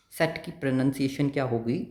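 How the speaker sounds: ordinary delivery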